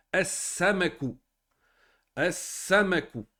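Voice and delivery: "As-Samaku" slowly with a long double s; marked shadda. Shown as a demonstration. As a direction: hold the s in "As-Samaku", no l. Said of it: "On rentre Alif Lam". (« As-Samaku » lent, s redoublé, sans lam)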